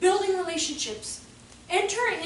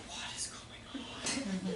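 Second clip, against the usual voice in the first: slow, hushed disbelief; stunned kid's whisper; 7 semitones lower, 14 dB quieter, much slower than usual. Acted. Kid after teacher's outburst, stunned slow whisper. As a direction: whisper it slowly as a baffled child.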